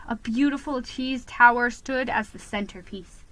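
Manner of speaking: usual speaking voice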